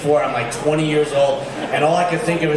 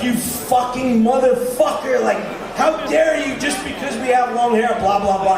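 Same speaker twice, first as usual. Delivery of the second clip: indignant rant; 6 semitones higher than usual. Retold or imitated. Kid from New York berating the police captain, outraged and laughing. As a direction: tear into him like a wronged New York kid.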